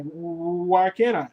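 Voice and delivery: slow, drawn-out mock bewilderment; playfully imitating a baffled guy; dumbfounded stammer. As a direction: stall on the first sound, as if the brain quit. Acting out guys unable to function.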